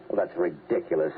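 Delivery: ordinary talk, nothing marked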